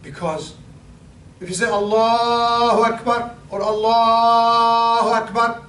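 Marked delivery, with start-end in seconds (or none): 1.7-3.4 "Allahu Akbar" slow and stretched, higher; acceptable takbir. Shown as a demonstration. Teacher demonstrating an acceptable elongated takbir. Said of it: "it's okay". 3.6-5.6 "Allahu Akbar" slow and stretched, higher; acceptable takbir. Shown as a demonstration. Teacher demonstrating an acceptable elongated takbir. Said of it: "it's okay".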